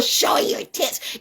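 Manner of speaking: hushed, scornful aside; whispered jab. Real, not acted. Mocking women online, a whispered jab. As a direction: whisper it, breathless and sly.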